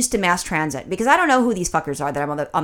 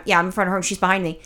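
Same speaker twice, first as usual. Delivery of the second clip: ordinary talk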